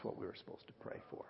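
hushed whispered revelation. Real, not acted. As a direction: whisper it as the point sinks in. Revealing the real prayer need.

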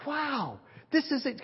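high-pitched awestruck wow; imitating a stunned woman; retold amazement. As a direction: marvel at it, high. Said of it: "And she goes".

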